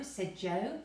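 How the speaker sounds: ordinary delivery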